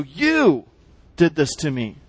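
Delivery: "you" stretched out and lifted, then a drop; pointed, drawn-out "you" before a short pause; stress on YOU. Shown as a demonstration. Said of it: "that word "you" is being emphasized".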